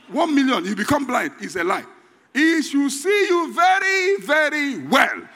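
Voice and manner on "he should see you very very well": slow, high preaching shout; stretched fiery shout. Real, not acted. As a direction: drag out very well at full volume.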